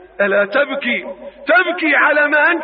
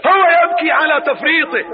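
normal talking voice

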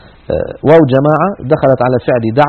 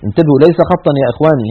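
ordinary talk

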